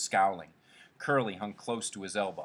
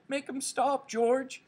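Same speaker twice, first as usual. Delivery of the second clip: very high pleading wail; terrified cry; 3 dB louder, slower than usual. A narrator's reading. Lenny begs George for help, bleating in terror. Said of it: "He cried".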